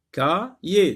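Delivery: "Cahier" broken into two separate syllables; split into syllables. Shown as a demonstration. Teacher dividing the word for learners, "Cahier" syllable by syllable. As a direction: pause between the syllables of "Cahier". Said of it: "On sectionne le mot en syllabes".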